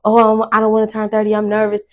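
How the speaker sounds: ordinary talk, nothing marked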